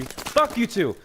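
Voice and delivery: high-pitched angry retort; heated retort. Real, not acted. Player swearing back at the game mid-story, heated. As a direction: throw it back, pitch shooting up.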